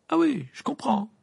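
high-pitched, irritated retort; huffy and put out. A narrator's reading. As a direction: answer back with annoyance. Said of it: "répliqua Hermione avec irritation".

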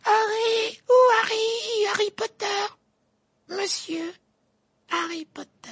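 very high, squeaky elf shriek; shrill elf voice. A narrator's reading. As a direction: squeal like a tiny excited elf. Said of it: "d'une voix suraiguë".

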